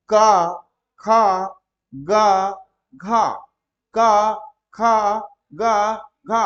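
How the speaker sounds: का, खा, गा, घा loud and drawn out, repeated; letter drill. Shown as a demonstration. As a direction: chant each syllable separately for students to copy. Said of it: "इतने ही वर्णों को बोलिएगा".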